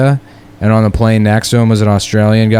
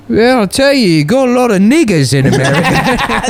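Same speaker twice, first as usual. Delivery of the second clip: put-on Australian accent; 9 semitones higher than usual. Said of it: "And he's like".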